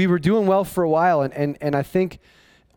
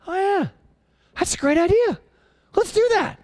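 mock crowd; 11 semitones higher than usual. Mocking the easily convinced crowd.

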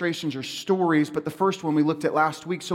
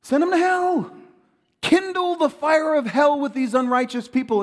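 mock fire-and-brimstone; 8 semitones higher than usual. Preacher voicing Pharisees' judgment on sinners, mock fury.

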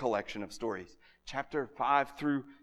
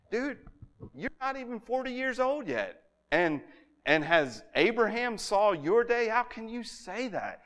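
scoffing leaders; 7 semitones higher than usual. Acted. Acting the scoffing religious leaders.

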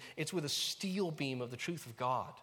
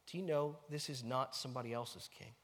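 ordinary talk

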